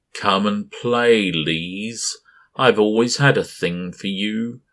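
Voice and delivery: slow, loud leering invitation; leering creature voice. A narrator's reading. Giant creature lures Lees, slow and leering. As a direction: croon it like a predator playing with prey.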